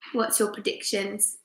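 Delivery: ordinary delivery, nothing marked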